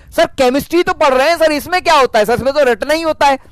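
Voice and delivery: high, pleading student voice; mock student protesting about chemistry; whiny student. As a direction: mimic a whining pupil making excuses. Mimics student excuse.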